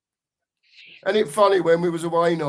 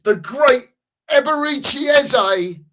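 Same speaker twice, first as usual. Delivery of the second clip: excited announcement; 10 semitones higher, 7 dB louder than usual. Real, not acted. Player comes on, hyping him.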